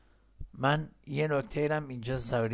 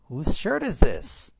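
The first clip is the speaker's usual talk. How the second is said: slow and loud, stressing shirt; slow model reading; 4 semitones higher, 8 dB louder, much slower than usual. Shown as a demonstration. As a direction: read it slowly for students to repeat. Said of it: "بله، بگید".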